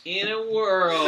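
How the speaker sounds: slow, loud trailer announcer; movie trailer voice. Pretend. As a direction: boom it like a trailer. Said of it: "every sentence should start with in a world".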